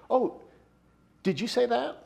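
light, high surprised question, pitch jumping up; surprised innocent question. Shown as a demonstration. Preacher showing the innocent tone of a question. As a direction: ask it as if mildly surprised. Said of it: "catch the shift in tone of my voice".

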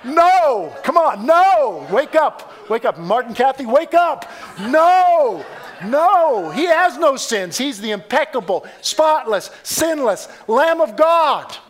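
loud, high preacher's shouts; full-force preaching. Real, not acted. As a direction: rouse a sleepy congregation, hammering each denial.

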